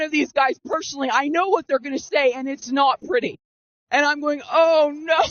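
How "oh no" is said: high mock-wail "oh no"; hammed-up high dismay; retold panic. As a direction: raise the voice into a comic wail of dread. Retold dread, repeated more strongly.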